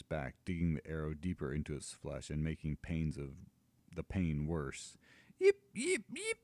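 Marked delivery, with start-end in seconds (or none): 5.4-6.4 very high, louder yelping; shrill pained dragon yips. Acted. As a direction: squeal in pain like a hurt pup. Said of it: "it shriels again".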